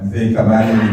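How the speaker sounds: ordinary talk, nothing marked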